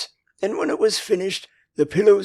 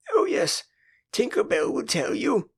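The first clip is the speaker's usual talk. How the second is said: much higher, mocking retort; scornful retort; 7 semitones higher than usual. A narrator's reading. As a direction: throw it back with scorn. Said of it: "Wendy retorted scornfully".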